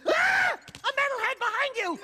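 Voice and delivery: high, loud fake panic shout; hammed-up alarmed yell. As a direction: scream a prank warning. Faking an alarm prank.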